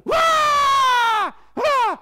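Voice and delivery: long high wail, then a short one; acted screaming. Child screams whenever papa demands, loud repeated acted shrieks. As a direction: scream at full voice to shut papa up. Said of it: "aku teriak kok".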